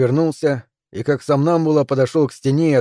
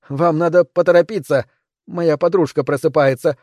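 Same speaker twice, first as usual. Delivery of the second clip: usual speaking voice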